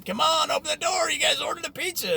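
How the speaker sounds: delivery guy calling through the door; mock yell through a door. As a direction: shout to the stoned kids inside. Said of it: "the guy's pounding on the door".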